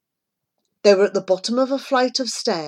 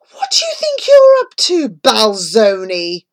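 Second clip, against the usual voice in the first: much higher, loud startled outburst; shocked gasp; 4 semitones higher, 8 dB louder than usual. A narrator's reading. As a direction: choke it out in disbelief. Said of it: "Granger gasped".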